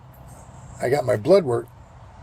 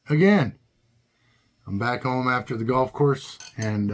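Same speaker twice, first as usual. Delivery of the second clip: normal talking voice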